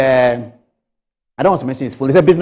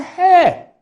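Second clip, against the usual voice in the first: alarmed exclamation; 5 dB louder than usual. Retold or imitated. Friend reacts in alarm.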